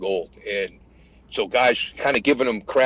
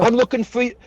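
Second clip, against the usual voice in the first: very high, earnest call back; high-pitched mock holler; retold shouted reply; 11 semitones higher, 3 dB louder than usual. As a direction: imitate a young private calling out. Private shouts back to sergeant.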